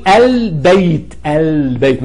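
البيت with the l fully sounded, "al-bayt"; moon lam. Shown as a demonstration. Teacher showing the moon lam, l sounded in البيت. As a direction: hold the l in البيت before the b. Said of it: "يبقى اللام ساكنة".

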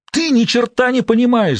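very high-pitched exasperated cry; agitated high outburst; frantic yell. A narrator's reading. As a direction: wail it in furious frustration. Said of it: "завопил израильтянин".

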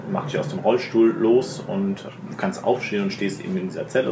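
ordinary talk, nothing marked

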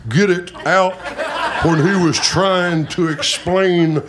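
halting Louisiana preacher impression; slowing, stuttery Louisiana delivery; Jimmy Swaggart. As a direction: struggle to get each word out, Louisiana style. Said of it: "Jimmy Swaggart kinda had that".

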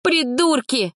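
high yelled insult; high angry cry; shouted insult. A narrator's reading. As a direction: yell at the idiots. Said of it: "крикнула".

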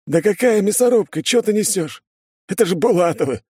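high-pitched, dismissive guard voice; scoffing. A narrator's reading. Smoker dismisses his mate's fear of battle, scoffing. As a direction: scoff at the very idea. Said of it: "усмехнулся курильщик".

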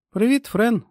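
високе жваве привітання; вигукни привітання значно вище; привітний вигук. A narrator's reading. Лорі вітає Френ, підскочивши. (радісне привітання високим голосом)

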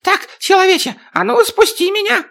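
high, bossy little dwarf; demanding dwarf voice. Acted.

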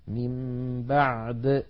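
من بعد slowly, stopped on a still د; recitation. Shown as a demonstration. Teacher recites a consonant-cluster example. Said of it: "هذه الأمثلة الثلاثة".

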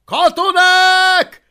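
slow, loud, high commanding bellow; menacing roar. A narrator's reading. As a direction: order the gates open. Alvin threatening, commanding soldiers.

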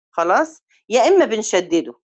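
ordinary talk

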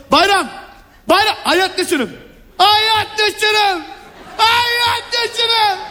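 hoog, slepend roepen door de telefoon. Acted. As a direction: roep uitgerekt naar je broer. Calling brother on phone, louder. (roept zijn broer door de telefoon)

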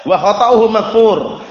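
slow teaching recital of "Wa khata'uhu maghfur"; slow, careful reading. Shown as a demonstration. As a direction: spell out "Wa khata'uhu maghfur" for students. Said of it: "wawunya wawu ibtidaiyah".

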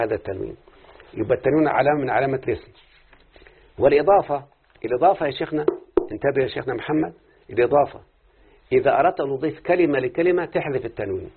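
normal talking voice

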